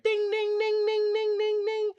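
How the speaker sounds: high, loud sing-song ding of a crossing bell; ping each ding up high like the warning bell; railroad bell imitation. Mimicking the crossing bell for the audience, high loud dings. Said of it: "all of a sudden I hear ding".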